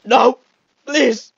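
high, loud begging; desperate plea. Real, not acted. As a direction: beg loudly in panic. Desperate plea during danger.

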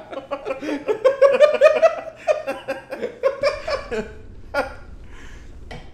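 long high laugh. Real, not acted. Long laugh after intro.

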